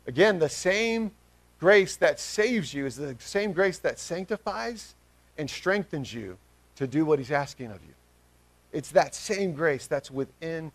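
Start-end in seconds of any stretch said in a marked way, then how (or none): none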